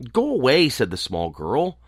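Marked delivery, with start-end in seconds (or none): 0.1-0.7 higher-pitched but moderate dismissal; insistent refusal. A narrator's reading. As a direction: shoo him off, raised pitch without shouting. Girl repeats her refusal, less alarmed.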